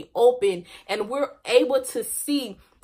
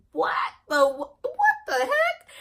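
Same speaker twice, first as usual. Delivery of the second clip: much higher, laughing what the heck; shocked disbelief. Retold or imitated. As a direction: squeal in happy disbelief. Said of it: "I was even surprised myself".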